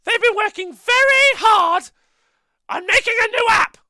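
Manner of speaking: very high squeaky puppet voice; Shilly voice. Acted. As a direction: shout it like a shrill little puppet. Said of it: "What does Shilly think of the app?".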